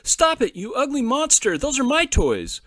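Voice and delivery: high, loud desperate scream. A narrator's reading. Little boy screaming at his brother burning his toys. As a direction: scream at the bully to stop. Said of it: "crying and screaming".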